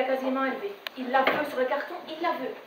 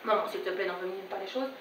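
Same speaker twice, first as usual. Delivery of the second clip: low, hushed appeasing plea; pleading with mother; 7 semitones lower, 9 dB quieter than usual. Acted. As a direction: placate her softly and low. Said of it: "Je lui dis". (supplication basse et apaisante à sa mère)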